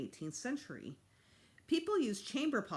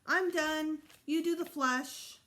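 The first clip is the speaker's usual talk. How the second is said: put-on high, raised child's call; little brother calling from the toilet; 10 semitones higher, 5 dB louder, slower than usual. A narrator's reading. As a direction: call from the bathroom like a small boy. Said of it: "Fudge called".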